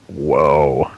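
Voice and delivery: slow, low, spaced-out drawl; like a bored stoner taking his time. Acted. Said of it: "the voice keeps sounding like it's a stoner".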